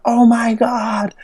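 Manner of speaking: put-on very high, slow, drawn-out kid's gasp of awe; amazed kid. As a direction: stretch it up high like a starstruck child. Imitates amazed kids.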